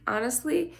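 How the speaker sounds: ordinary talk, nothing marked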